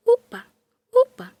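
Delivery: high, loud little hop calls; jumping cries. A narrator's reading. Little doll straining to reach the window, high jumping cries. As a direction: squeal each upa like a jumping doll. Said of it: "A bonequinha pula assim".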